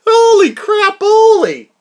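high, loud exclamation; breathless exclaim. Real, not acted. Vlogger recovering after his shout, high loud exclamation. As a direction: gasp it out. Reacting to own outburst.

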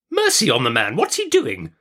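high-pitched exasperated outburst; exasperated aunt, voice shooting up; impatient cry. A narrator's reading. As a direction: exclaim with rising impatience. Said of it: "cried my aunt impatiently".

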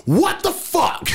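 high, slow, stunned exclamation imitating her; mock shocked girlfriend. Girlfriend coming back in after the finale, hammed-up shock. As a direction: drag it out way up high in disbelief.

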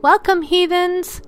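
high, loud showman greeting; dramatic welcome. Acted. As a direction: greet them like a horror host. Host's dramatic show greeting.

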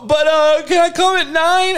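put-on pleading kid. Kid asks dad for later curfew, high pleading voice. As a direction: pipe up like a kid bargaining.

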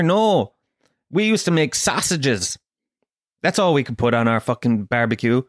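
ordinary delivery

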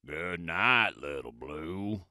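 a sleepy toad croaking goodnight; low croaky toad voice. A narrator's reading. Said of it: "he said".

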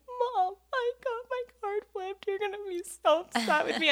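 mock wailing little kid; imitating a sobbing child. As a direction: play yourself at seven, crying to your mom. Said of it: "I'm sobbing, literally sobbing".

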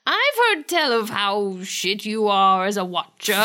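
hammed-up witchy British accent; Missus Post. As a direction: pronounce judgement in mock British. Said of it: "are you doing a British accent".

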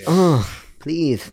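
lisped mock suave plea; drawn-out put-on lisping please; smarmy, lightly lisped; Doki Doki voice. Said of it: "uh, the voice".

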